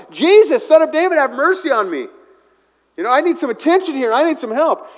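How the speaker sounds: high, loud shout for help. Acted. Blind beggar begging Jesus for attention, shouting out. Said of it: "he starts shouting out".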